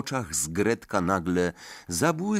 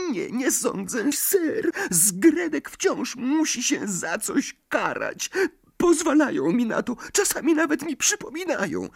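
very high, whimpering elf; a frightened servant pleading; tearful house-elf. A narrator's reading. Dobby about punishment. (płaczliwy skrzat)

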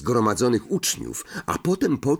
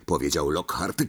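usual speaking voice

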